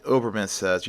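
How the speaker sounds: usual speaking voice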